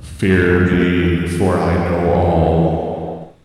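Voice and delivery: deep, slow, echoing voice from the sky; booming god voice. Acted. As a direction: proclaim it like an almighty deity, very slowly. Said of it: "use the god voice".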